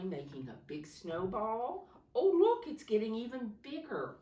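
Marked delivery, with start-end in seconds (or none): 2.1-4.2 high, loud delighted exclaim; excited. Real, not acted. Teacher showing children the growing snowball, excited and loud. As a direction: marvel out loud at the picture.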